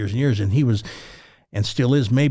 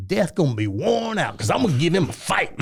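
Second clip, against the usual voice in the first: put-on defiant old man; 5 semitones higher than usual. [name] vows to fight death.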